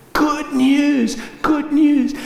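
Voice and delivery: excited calling out. Acted. Messenger calling out the news.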